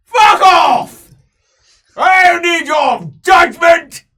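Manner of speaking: very loud, high, slowed angry bellow; dwarf noble screaming at the slayers; furious dwarf shout. Acted. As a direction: roar it at them in drunken rage. Noble dwarf snapping angrily.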